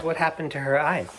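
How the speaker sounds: plain reading voice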